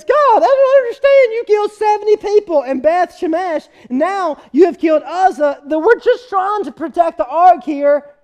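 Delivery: high, loud pleading cry; crying out to God. Acted. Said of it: "looks up to God and says".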